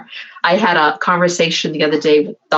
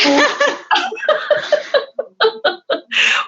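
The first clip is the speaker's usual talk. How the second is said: a long high burst of real laughter. Real, not acted. Laughing at her own bragging story.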